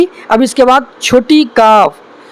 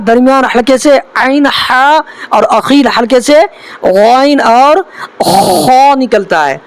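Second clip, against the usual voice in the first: ordinary talk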